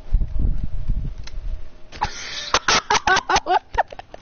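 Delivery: high breathy giggling; breathless laugh. Real, not acted. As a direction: wheeze with laughter. Laughs at the waddling.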